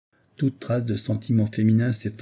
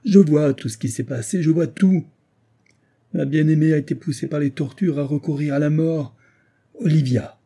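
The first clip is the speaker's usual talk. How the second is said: higher, louder anguished outcry; a husband's frantic fury at the widow; furious despair; 6 semitones higher, 4 dB louder than usual. A narrator's reading. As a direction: rage and despair spilling over. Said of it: "dans le paroxysme de la fureur et du désespoir".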